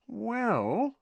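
high, drawn-out hesitation; slowly. Acted. Parvati considering a partner for Ron, slowly. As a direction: stretch the word while thinking it over. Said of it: "said Parvati slowly".

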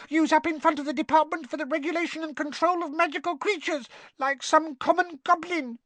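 high-pitched, indignant squeaky rant; scolding house elf. A narrator's reading. Winky recounts telling off Dobby, scolding squeak. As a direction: scold like a fussy little elf. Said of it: "a teeny quivering squeak of a voice".

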